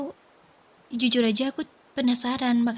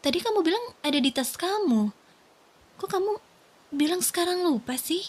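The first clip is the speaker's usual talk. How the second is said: confused disbelief; 6 semitones higher than usual. Acted. Wife confronts husband's changing story.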